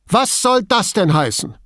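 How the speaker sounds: high, sharp, indignant demand; furious. A narrator's reading. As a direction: whirl round on him angrily. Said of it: "Sie fuhr wütend herum".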